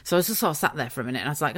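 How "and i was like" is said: usual speaking voice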